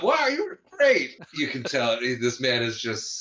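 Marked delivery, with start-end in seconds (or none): 0.0-1.2 very high mock-admiring gush; congratulating family. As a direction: imitate a family fawning over a hero. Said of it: "They immediately go to Bob".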